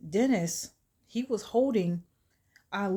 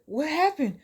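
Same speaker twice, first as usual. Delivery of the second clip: high, loud, confused stammering; bewildered; 12 semitones higher, 6 dB louder than usual. Retold or imitated. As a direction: blurt it out in total confusion. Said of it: "he has no idea what just happened".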